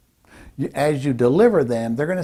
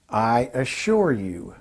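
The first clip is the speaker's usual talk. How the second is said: slow, measured assurance; definitive; 3 semitones lower, slower than usual. Shown as a demonstration. Speaker coach models a power phrase, slow and assuring. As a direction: take your time over each word. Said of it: "hear what they sound like".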